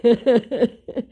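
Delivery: short real giggle; tickled laugh. Real, not acted. Tickled by the scammer's message, real short laugh. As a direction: break into an amused laugh. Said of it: "that tickled me".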